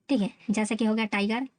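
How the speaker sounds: usual speaking voice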